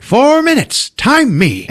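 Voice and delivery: high, loud mock boast; eager shout. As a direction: call it out like a race challenge. Dad eagerly races to change tire.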